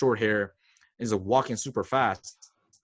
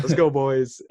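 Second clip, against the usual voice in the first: louder, higher, laughing through it; laughing hype; 4 semitones higher, 6 dB louder, slower than usual. Real, not acted. [name] laughing at his own pump-up to his friend. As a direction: cheer your squad on while cracking up.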